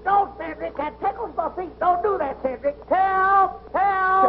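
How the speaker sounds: high yelps building to calls for help; panicked cries. Acted. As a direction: squirm and holler for rescue. Calls for help while tied.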